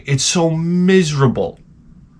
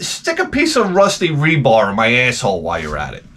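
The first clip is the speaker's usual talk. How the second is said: ordinary talk, nothing marked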